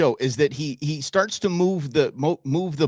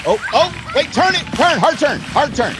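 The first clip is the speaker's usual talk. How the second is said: high, loud shouted warnings; urgent yelling; 11 semitones higher, 6 dB louder than usual. Real, not acted. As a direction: yell steering orders at the driver. Yelling directions at driver on screen.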